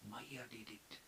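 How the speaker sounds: very soft imitated whisper. Madhumangal secretly tells who is responsible, whispered. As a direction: whisper it like a secret tell-tale. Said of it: "So Madhumangal whispering way".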